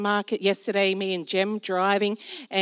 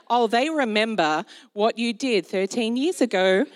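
normal talking voice

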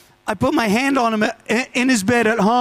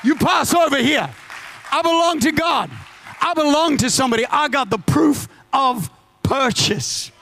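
preaching; 4 semitones higher than usual. Acted. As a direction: proclaim it over the congregation, full force. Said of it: "I'm gonna get preachy".

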